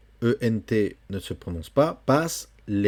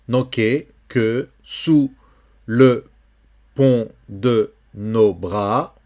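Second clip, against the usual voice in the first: poem reading; 6 dB louder, much slower than usual. Shown as a demonstration. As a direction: lecture lente et appuyée, vers par vers. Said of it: "lecture lente du poème". (lecture de poème lente et sonore)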